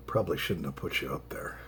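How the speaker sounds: hushed aside to the cat; soft whisper to a pet. Real, not acted.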